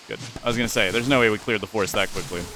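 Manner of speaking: usual speaking voice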